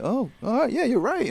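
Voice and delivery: giving in. Retold or imitated. Fighter retells conceding to his manager, giving in. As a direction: concede in a lifted little voice. Retold grudging agreement with manager.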